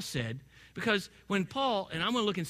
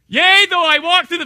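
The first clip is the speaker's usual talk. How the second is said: mock panicked prayer; 11 semitones higher, 17 dB louder than usual. Jokingly starting a prayer in fright.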